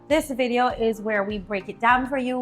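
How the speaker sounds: usual speaking voice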